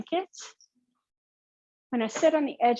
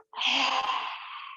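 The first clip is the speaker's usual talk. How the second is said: forceful open-mouth breath out; lion's breath exhale; 3 semitones higher, 6 dB quieter than usual. Shown as a demonstration. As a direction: breathe out hard like a lion. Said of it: "and lion's breath out".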